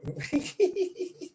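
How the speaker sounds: a high, airy burst of laughter. Real, not acted. Host laughing on stream.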